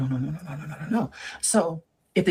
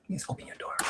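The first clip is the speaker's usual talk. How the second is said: begging whisper; 13 dB quieter than usual. Retold or imitated. Boyfriend begging at door.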